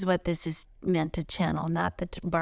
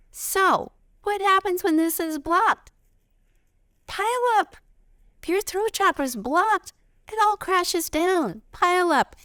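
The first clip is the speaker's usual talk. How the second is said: alarmed raised voice; 12 semitones higher, 5 dB louder than usual. Acted.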